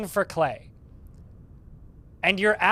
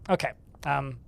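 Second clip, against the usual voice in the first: ordinary delivery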